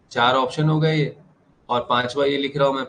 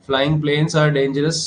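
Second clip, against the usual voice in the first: slow example read; 2 semitones higher, 3 dB louder, much slower than usual. Shown as a demonstration. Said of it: "example sentence read slowly".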